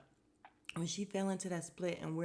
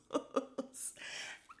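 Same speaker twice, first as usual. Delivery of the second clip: breathy laugh; 11 semitones higher than usual. Real, not acted. Laughs after whisper.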